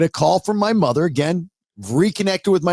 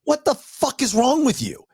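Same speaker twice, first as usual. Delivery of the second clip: fed-up son; 9 semitones higher than usual. Retold or imitated. Son at his limit with confusing mother, exasperated. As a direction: reenact losing patience with your mother. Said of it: "I finally got to the point where".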